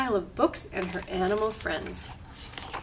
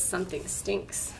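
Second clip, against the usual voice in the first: softly repeated; 8 dB quieter than usual. Acted. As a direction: say it quietly to the child. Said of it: "She says".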